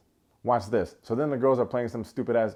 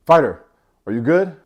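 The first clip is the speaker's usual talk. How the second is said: much louder barked check-in; referee shout; 5 semitones higher, 11 dB louder than usual. Retold or imitated. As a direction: call out over the crowd noise. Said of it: "the referee's like".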